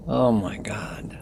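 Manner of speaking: slow, soft Oh, my God; soft amazed murmur; hushed awe. Real, not acted. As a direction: say it slowly, overwhelmed. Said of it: "awed reaction".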